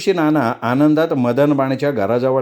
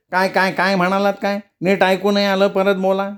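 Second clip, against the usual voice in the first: high, hard-of-hearing elder; nasal old man; 6 semitones higher than usual. A narrator's reading. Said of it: "नाकात बोलणारा".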